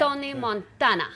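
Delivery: put-on Tony Montana with a light Australian accent; Aussie Tony Montana. Naming his character, light Australian-accented Scarface. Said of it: "Scarface, the Australian version".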